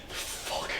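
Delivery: unvoiced, anguished swear under the breath; hushed self-reproach; whispered curse. Guilty confession of his fear.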